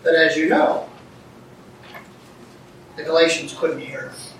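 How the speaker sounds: usual speaking voice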